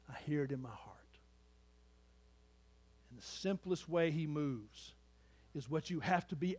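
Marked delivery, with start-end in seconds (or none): 0.1-1.0 soft, breathy whisper of a confession. Real, not acted. Pastor sharing his faith, in a whisper. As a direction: whisper it as a private truth. Intimate personal testimony.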